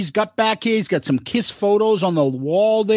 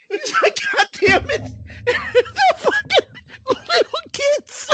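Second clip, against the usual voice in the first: high pitched words through laughter; laughing while talking; 13 semitones higher than usual. Real, not acted. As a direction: tell it while cracking up. Laughing while telling story.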